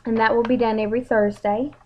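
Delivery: ordinary talk, nothing marked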